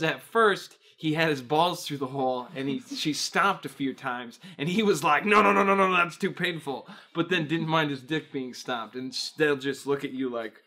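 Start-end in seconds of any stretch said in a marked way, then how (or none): 5.2-6.9 loud, raised string of protests; hammed-up alarm, high and loud; mock pained protest. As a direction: blurt it out like an imitated plea to stop. Acted protest of man in pain.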